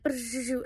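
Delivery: quiet lip trill "Brrr"; soft eye flutter trill. Retold or imitated. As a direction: let a small soft buzz slip out. Eyes fluttering sensation imitated.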